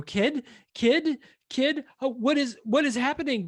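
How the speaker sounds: half-screamed parody of panic; mock frantic calling. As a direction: imitate a panicked parent calling out. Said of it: "screaming the kid's name".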